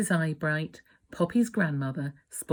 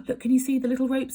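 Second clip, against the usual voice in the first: fast, higher, eager question; excited aside; 7 semitones higher, much faster than usual. Real, not acted. Parent showing the child the picture, excited and fast. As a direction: point it out eagerly. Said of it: "aside to child, excited".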